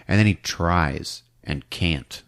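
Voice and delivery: ordinary talk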